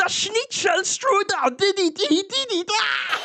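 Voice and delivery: put-on high, slow, shouted German accent. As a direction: scream his name like an excited German announcer. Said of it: "Why am I doing the German accent still?".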